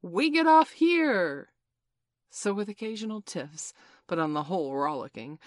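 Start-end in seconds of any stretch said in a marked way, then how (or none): 0.0-1.5 loud, high, drawn-out captain's call; captain's cry. A narrator's reading. Peter rousing the sleeping children, shouted captain voice. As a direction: call it out slowly like a commanding captain. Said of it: "cry in his captain voice".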